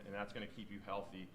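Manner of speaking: normal talking voice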